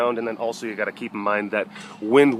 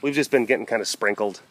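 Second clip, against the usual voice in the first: ordinary delivery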